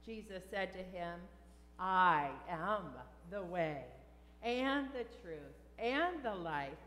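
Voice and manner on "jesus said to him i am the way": ordinary talk, nothing marked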